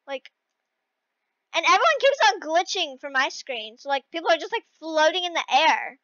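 normal talking voice

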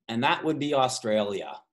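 ordinary delivery

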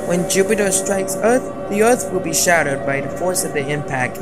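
ordinary talk, nothing marked

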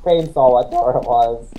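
ordinary talk, nothing marked

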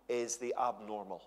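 normal talking voice

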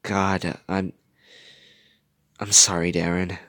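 halting, subdued apology with pauses; sighed apology. A narrator's reading. Gogo apologises to Darren, sighing. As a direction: sigh it out, hesitating. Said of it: "Gogo sighed".

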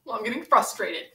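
ordinary talk

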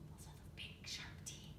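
fast hushed whisper; whispered aside. Real, not acted. Teacher whispering a scary detail to the kids. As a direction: lean in and whisper it quickly. Aside to class mid-story.

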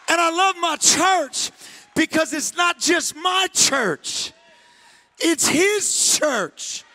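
high, loud, impassioned preaching; preacher roaring the point home; preaching at full force. Acted. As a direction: drive it home to the crowd.

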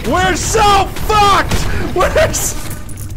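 slow, high, loud wail; drawn-out despairing yell; doomed shout. Real, not acted. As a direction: cry out that all is lost. Panicked cry at losing game.

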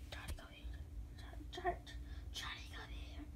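slow, unvoiced creepy whisper. Shown as a demonstration. Prank caller demos his creepy voice to the dispatcher. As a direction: breathe Johnny come here like a ghost. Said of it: "I like to do this voice".

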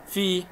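في held high as a long "fii"; long vowel. Shown as a demonstration. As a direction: draw out the i of في. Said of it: "مد بالياء".

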